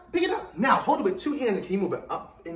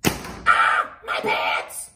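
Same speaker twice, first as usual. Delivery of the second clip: mock panic; 10 semitones lower, 7 dB louder, much slower than usual. Shown as a demonstration. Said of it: "Touch your head and say".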